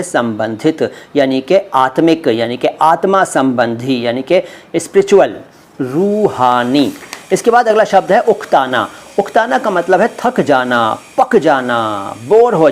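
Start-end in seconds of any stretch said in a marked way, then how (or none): 5.8-6.9 रूहानी drawn out slowly, syllable by syllable; slow careful word. Shown as a demonstration. Teacher modelling रूहानी slowly for viewers. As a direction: stretch रूहानी slowly so learners catch it.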